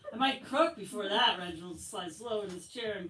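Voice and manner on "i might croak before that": put-on loud whiny boy. Boy fakes dying in class.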